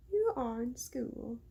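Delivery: slow British accent test; trying British accent. Shown as a demonstration. Narrator practicing the mayor's voice, British accent. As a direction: feel out a British accent slowly. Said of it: "Let me get the British accent again".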